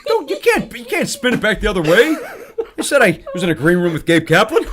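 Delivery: high, loud, amused objection; real laughter through mock indignation; laughing protest. Real, not acted. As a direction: protest while cracking up.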